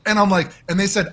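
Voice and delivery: ordinary talk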